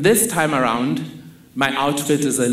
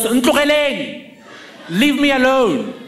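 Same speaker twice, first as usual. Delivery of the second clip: retold yell; 8 semitones higher than usual. Mother yells out the window at a lurking car, high retold yell. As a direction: imitate mother yelling a warning. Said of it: "and yells".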